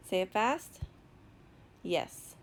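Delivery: ordinary talk, nothing marked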